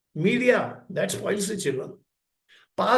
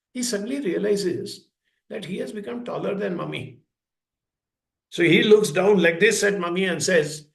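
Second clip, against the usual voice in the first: usual speaking voice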